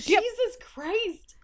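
high, slow, drawn-out groan of dismay; exasperated outburst. Real, not acted. Podcast host groaning at the script, high and drawn out. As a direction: stretch it out in mock despair.

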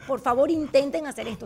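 normal talking voice

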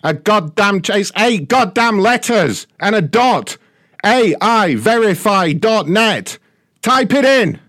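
furious yelling, pitch climbing; angry shouting. Real, not acted. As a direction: shout it out in exasperation. Scammer yelling at caller.

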